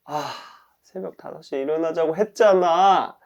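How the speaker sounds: sighing, then rising into reproach; disappointed. Pretend. Person who overslept until eleven, disappointed self-reproach. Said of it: "저 자신한테 엄청 실망하겠죠".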